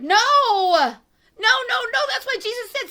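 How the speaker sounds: high, loud, exasperated "No, no, no"; heated outburst. Real, not acted. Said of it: "I get a little heated".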